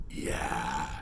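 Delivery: deep creepy murmur; low eerie aside; spooky voice. Acted. Spooky sign-off.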